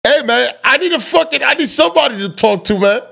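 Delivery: high-pitched, worked-up complaint; exasperated plea. Real, not acted. Host begging his sidekick to talk on the show, high exasperated plea.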